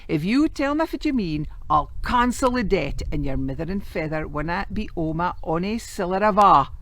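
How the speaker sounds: put-on exasperated moneylender begging the boy, frustrated plea. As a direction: beg him to just say it.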